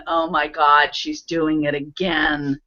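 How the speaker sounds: annoyed roommate. Retold or imitated. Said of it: "and they're going".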